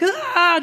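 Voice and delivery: high-pitched mock baby scream; imitated toddler scream. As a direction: squeal out a child's scream, playfully imitated. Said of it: "just screaming at me".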